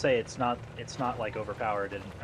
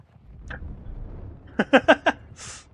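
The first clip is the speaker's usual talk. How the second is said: breathy high laugh; 7 dB louder than usual. Real, not acted. After dying.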